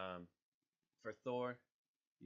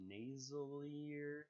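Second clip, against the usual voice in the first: Nasalier said slow and higher, questioning; puzzled drawn-out word; 6 semitones higher, much slower than usual. Shown as a demonstration. YouTuber doubting his own word, slow and higher. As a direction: stretch Nasalier out, unsure it exists. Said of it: "Is that a word?".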